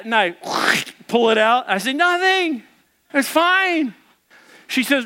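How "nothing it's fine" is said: high-pitched fake nonchalance; innocent cover-up. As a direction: pitch it high, pretending all is well. Caught husband covers up damage.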